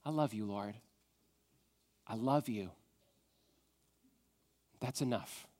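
very soft, low, tender "I love you, Lord"; hushed worshipful murmur; soft prayer. Shown as a demonstration. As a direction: model a quiet prayer for them to copy. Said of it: "tell him that".

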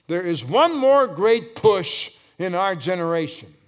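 loud, driving pulpit delivery; full-force preaching. Real, not acted. Preacher rousing the church about the next generation, full-force preaching.

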